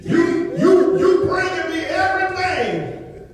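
high-pitched, driving preacher cadence; impassioned preaching. Acted. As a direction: preach it as God's complaint to the people. Preaches in God's voice.

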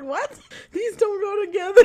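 high, loud, near tears with laughter; disbelieving line broken by laughter; laughing disbelief. Retold or imitated. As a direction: cackle out the confusion. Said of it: "I'm just like".

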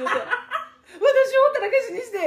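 crying mother voice. Retold or imitated.

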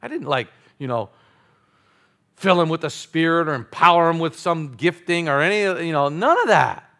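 ordinary talk, nothing marked

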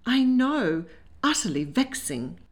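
mildly vexed, high start falling away; unhappy. A narrator's reading. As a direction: sigh it out as a mild complaint. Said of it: "said unhappily".

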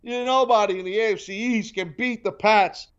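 mocking pundits. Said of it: "everybody's talking about".

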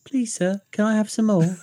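high pitiful orphan plea; Oliver Twist begging. Retold or imitated. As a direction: beg like a starving orphan. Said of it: "with our little wooden bowls".

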